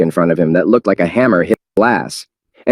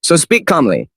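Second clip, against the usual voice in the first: serious; 8 semitones higher than usual. Acted. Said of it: "in a very serious mood".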